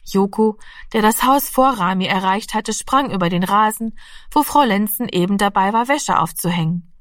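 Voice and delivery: ordinary delivery, nothing marked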